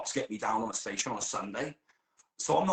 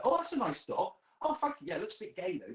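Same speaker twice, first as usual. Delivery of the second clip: high, sing-song chatty voice; high mock exchange; 14 semitones higher than usual. Me chatting to a dog owner, high and sing-song. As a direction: act out the passing remark, high.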